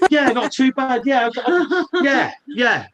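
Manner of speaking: high, loud laughing reply. Real, not acted. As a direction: laugh heartily while answering. Laughs at question about his week.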